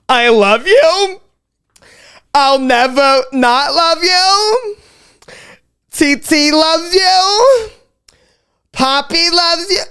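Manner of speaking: hammed-up weepy mother gushing over her child; Italian mother speech. An Italian mother at a sweet sixteen, slow and tearful. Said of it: "typical Italian mother sweet sixteen speech".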